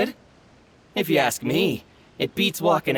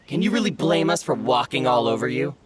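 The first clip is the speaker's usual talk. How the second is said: usual speaking voice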